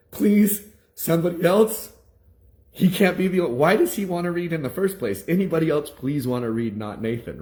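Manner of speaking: high, loud, exasperated pleading; desperate teacher. Retold or imitated. Teacher begging for anyone but the bad reader, exasperated. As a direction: plead loudly, pitch jumping up, then settling.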